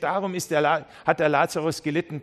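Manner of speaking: ordinary talk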